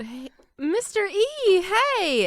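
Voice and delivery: high, loud drawn call; big in-character shout across the fight; yelling out. Acted. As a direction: holler to get someone's attention. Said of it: "I yell out".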